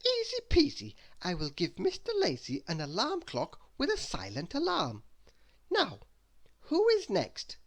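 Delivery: put-on high, bright little girl voice, pleased with herself; Little Miss Birthday voice.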